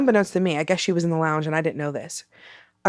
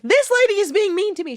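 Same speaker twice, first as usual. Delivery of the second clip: put-on whiny childish complaint; 13 semitones higher than usual. Whining to her friend.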